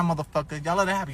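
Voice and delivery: normal talking voice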